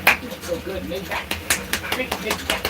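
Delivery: high, sing-song praise to a running dog; doggy praise voice. Real, not acted. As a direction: cheer the dog on sweetly.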